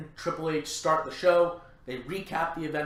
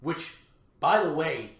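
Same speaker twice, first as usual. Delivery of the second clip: ordinary delivery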